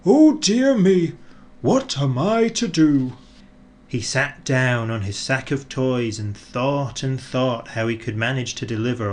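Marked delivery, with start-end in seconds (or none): 0.1-3.1 a hint of a fretting old Santa; worried Father Christmas. A narrator's reading. Father Christmas stuck in the snow, fretting. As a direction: fret aloud, higher and louder. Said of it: "thought Father Christmas to himself".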